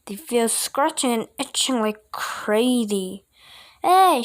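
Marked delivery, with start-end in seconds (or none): 3.8-4.2 loud angry yell; coach shouting. A narrator's reading. Coach yelling about the lotion, loud shout. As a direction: bark it like a coach. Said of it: "shouted the coach".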